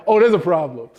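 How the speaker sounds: dawning, half-amused alarm; mock realization. Mock realization after shade.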